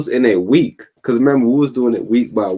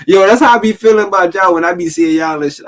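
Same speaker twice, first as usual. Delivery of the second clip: ordinary talk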